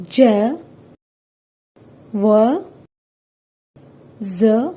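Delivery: usual speaking voice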